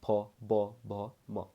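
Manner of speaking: फ, ब, भ, म each said alone, lips pressed together; teacher models फ, ब, भ, म with closed lips; lip letter demo. Shown as a demonstration. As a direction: sound out फ, ब, भ, म one at a time. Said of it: "जैसे मैं कहूँ प".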